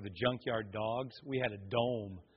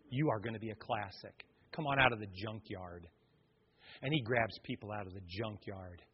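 lowered, quiet preaching; soft altar appeal; 5 semitones lower, 8 dB quieter than usual. Real, not acted. Preacher inviting the congregation out of the junkyard, soft appeal. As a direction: draw them in gently, low and soft.

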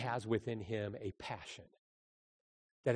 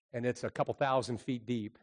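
ordinary talk

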